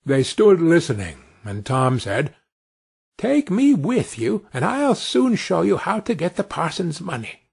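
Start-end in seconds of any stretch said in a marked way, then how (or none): 3.2-7.4 high, small eager voice; lightly voiced little boy making an offer; eager tiny Tom. Tiny Tom offers to help the thieves, lightly voiced and eager.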